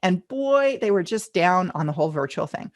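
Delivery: normal talking voice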